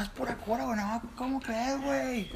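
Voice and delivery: high mock Tijuana slang; Tijuana accent. Friend repeating Tijuana phrase, high Tijuana accent. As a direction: imitate Tijuana banter. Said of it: "es, no".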